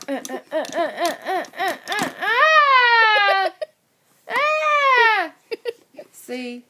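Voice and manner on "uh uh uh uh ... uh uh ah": rhythmic grunts rising into a high yell; mock battle cries; grunting fight cries. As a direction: scuffle noises ending in a scream. Tongue war fight.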